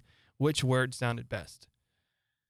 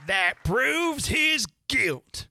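high, loud, very slow proclamation; triumphant verdict; 11 semitones higher, 5 dB louder, much slower than usual. A narrator's reading. Court declares the knave guilty, loud and triumphant. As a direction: stretch each word into a ringing accusation.

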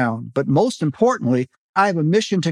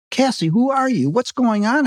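alarmed question; 8 semitones higher than usual. A narrator's reading. Narrator shocked, confronting Cassie.

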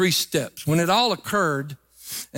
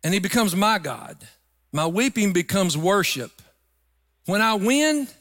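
ordinary talk

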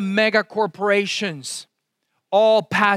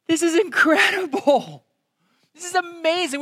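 higher voice, marvelling at the passage; awed wonder; 10 semitones higher than usual. Retold or imitated. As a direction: breathe it out in amazement. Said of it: "and we would be like".

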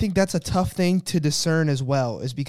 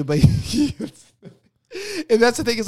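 laughing; 8 semitones higher, faster than usual. Real, not acted. Laughing at the prayer joke.

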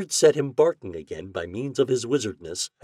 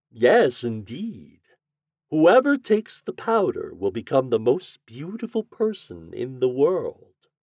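high, theatrical wizard voice; raised-pitch storybook wizard's promise; 5 semitones higher, 5 dB louder, slower than usual. A narrator's reading. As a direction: proclaim the powder's power grandly, drawing out the ending.

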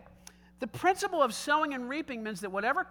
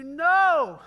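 emphatic refusal; 5 semitones higher, 10 dB louder than usual. Acted. Answers the imagined objector firmly.

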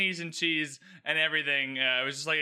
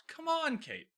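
high "Come on", then Kate dropped way down; fondly fed up with her; mock despair at the blunder; exasperated; 11 semitones higher, 4 dB quieter, slower than usual. Real, not acted.

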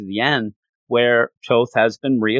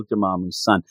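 ordinary talk, nothing marked

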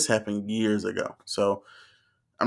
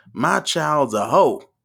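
higher mock preacher, last words drawn out loud; 6 semitones higher, 6 dB louder, much slower than usual. Preacher quoting a bumper sticker, mock preaching. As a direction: build to a slow pulpit punchline. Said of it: "and he said".

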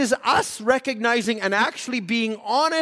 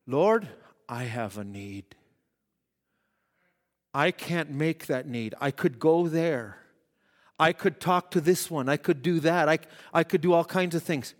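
quiet prayer; 6 semitones lower, 3 dB quieter than usual. Retold or imitated. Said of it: "We're moving into a higher level".

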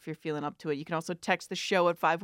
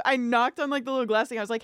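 ordinary talk, nothing marked

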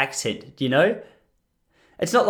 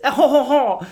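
high hammed-up French chortle; mock French laugh; 9 semitones higher, 4 dB louder than usual. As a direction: fake a laugh in French accent. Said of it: "I don't know any other French words".

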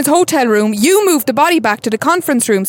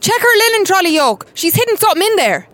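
higher, urgent alarmed order; raised-pitch shout of alert; urgent call-out; 7 semitones higher than usual. Acted.

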